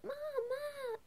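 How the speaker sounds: high-pitched retold call for mom; urgent call. Daughter urgently calling her mother, mock high.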